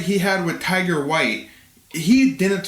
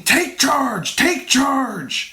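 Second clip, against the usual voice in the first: higher-pitched mock yell; imitated cornerman call; 5 semitones higher, much slower than usual. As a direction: call it out like a fight coach. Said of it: "someone in his corner keeps saying".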